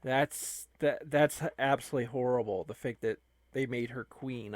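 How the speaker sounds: ordinary talk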